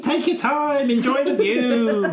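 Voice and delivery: high, laughing little creature voice; chirpy high voice through laughter; high character voice. Acted. Winkle voice, laughing.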